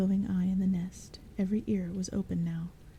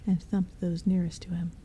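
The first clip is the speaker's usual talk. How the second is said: ordinary delivery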